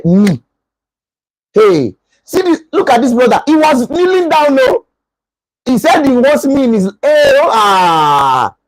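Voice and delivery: rising pitch into a mock wail; emotional outburst. An emotional lady venting over her boyfriend, building to a mock wail. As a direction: build from complaint into a hammed-up cry. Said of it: "the emotional type will say".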